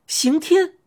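high, startled call of a name; high-pitched involuntary exclamation; startled cry. A narrator's reading. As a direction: cry out in sudden recognition. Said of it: "我不由得叫了一声".

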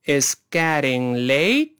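慢慢读，late拖长并上扬; 带学生慢速跟读，词词拉开; 慢速领读. Shown as a demonstration. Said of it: "再来一遍慢速". (慢速示范，句尾挑起)

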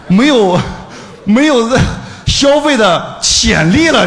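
drawn out, high and loud, each word hammered; "没有，没有消费的潜力了" pushed slow, high and loud; mocking punchline. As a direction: spell out the joke slowly with mock outrage. Punchline on being drained dry.

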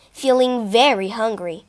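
usual speaking voice

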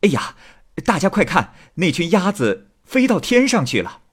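high-pitched excited call; urgent shout. A narrator's reading. Husband calling out to the woman on the passing boat, urgent high cry. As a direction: call everyone to look, voice pitched well up. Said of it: "情急之下大喊一声".

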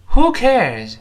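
high, loud "who cares"; read aloud boldly. Shown as a demonstration. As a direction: belt out both words, falling at the end. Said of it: "我们大声读一遍".

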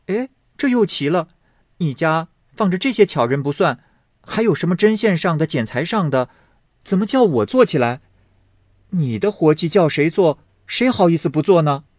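high, fast incredulous teasing; young lady laughing off a request, high and fast; amused young lady. Acted. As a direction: act amazed and wave it off. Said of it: "史湘云笑道".

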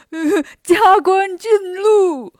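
slow, loud, drawn-out mock blessing in a put-on voice; hammed-up blessing. Bajie clowns with the hat on Longevity, slow loud put-on voice. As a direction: stretch it out like a clowning pig. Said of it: "拍着手哈哈大笑说".

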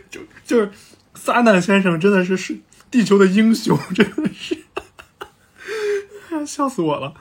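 real laughter breaking into loud, high words; cracking up. Real, not acted. Narrator praising Satan as Earth's hero, cracking up. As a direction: talk through helpless laughing.

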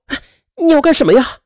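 high, quick startled protest; alarmed, rushed question. A narrator's reading. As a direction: stop him in a panic. Woman grabs man's arm, alarmed.

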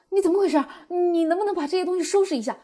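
high, loud re-enacted outburst at a niece; agitated scolding. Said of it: "被我这么激动紧张的那个情绪".

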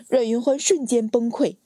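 ordinary delivery, nothing marked

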